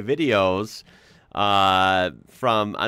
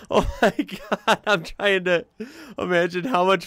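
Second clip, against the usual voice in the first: laughing; 8 semitones higher than usual. Real, not acted. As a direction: talk while still cracking up. Still laughing at the comment.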